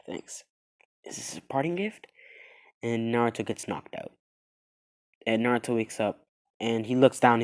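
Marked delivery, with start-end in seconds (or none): 0.1-2.1 weak whisper. Acted. Naruto weak, fading out.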